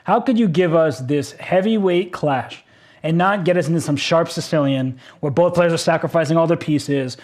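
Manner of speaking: ordinary delivery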